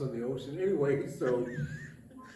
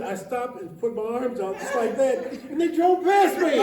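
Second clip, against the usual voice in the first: high, louder laughing retelling; laughing while quoting; 7 semitones higher, 5 dB louder than usual. Retold or imitated. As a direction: quote squeezed out through laughter.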